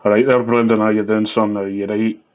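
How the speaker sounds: put-on Scottish greeting; Scottish accent. Shown as a demonstration. Co-host asked to go Scottish greets Brendan, Scottish accent. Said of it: "Go Scottish".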